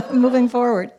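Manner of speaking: laughing through it. Real, not acted. Laughs at own stumble.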